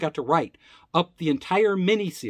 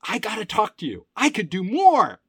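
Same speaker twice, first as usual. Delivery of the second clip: retold eager plea, pitched up; eager pleading; 7 semitones higher, 4 dB louder than usual. Young employee pitching himself to the company head, eagerly pleading.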